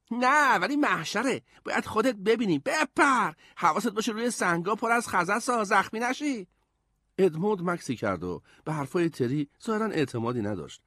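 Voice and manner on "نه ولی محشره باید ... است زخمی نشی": put-on high-pitched kid calling up; eager boy. A narrator's reading. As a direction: urge your brother to come and see. Voices Terry urging brother down.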